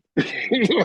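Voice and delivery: a quick real chuckle, rising; short laugh. Real, not acted. Chuckling at Ahmad's reply, short laugh. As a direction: let a short laugh slip out.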